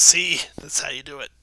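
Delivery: loud gloating goblin. Acted. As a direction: crow over the kill, loud. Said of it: "The goblin says".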